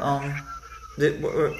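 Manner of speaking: usual speaking voice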